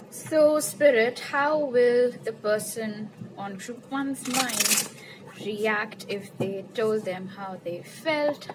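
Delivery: slow, stretched-out question to Spirit; playful, deliberately slow invocation; playfully drawn out. Real, not acted. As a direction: draw each phrase out slowly for fun. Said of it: "making this sound so much fun".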